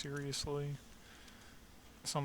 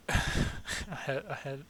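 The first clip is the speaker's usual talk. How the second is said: a real laugh breaking into the words; loud laughing burst over the words; 6 dB louder, much slower than usual. Real, not acted. Catching herself forgetting what to say, laughing. Laughs after losing her thought.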